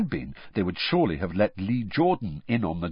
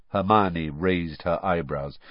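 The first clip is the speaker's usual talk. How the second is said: plain reading voice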